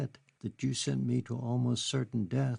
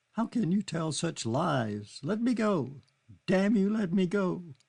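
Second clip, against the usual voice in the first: high, raised, hysterical cry; 8 semitones higher, 3 dB louder than usual. Acted. Kitty crying hysterically at Charlie to let her go. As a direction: scream it through the tears. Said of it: "crying hysterically now".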